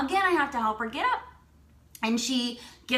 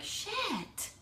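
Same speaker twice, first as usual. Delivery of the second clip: hushed, higher-pitched, awed curse; stunned; 8 semitones higher, 11 dB quieter than usual. Retold or imitated. Woman floored after being amped for photo shoot, hushed. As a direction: let it out softly, floored.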